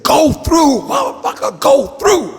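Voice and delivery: very high, loud screamed orders; frantic shout. Retold or imitated. G-Man ordering the driver through the red light, screaming high. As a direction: yell it over the engine in a panic. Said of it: "He said".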